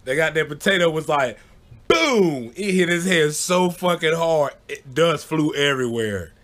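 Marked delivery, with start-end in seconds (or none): 1.9-2.5 boom. Retold or imitated. Potato hits the head.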